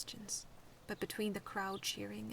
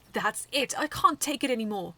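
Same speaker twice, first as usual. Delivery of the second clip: loud, exasperated shout; angry outburst; 4 semitones higher, 14 dB louder than usual. A narrator's reading. Medaby losing patience with the battle, shouting. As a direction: explode with frustration.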